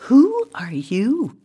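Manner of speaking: high, slow puzzled question. A narrator's reading. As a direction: draw the question out in wonder.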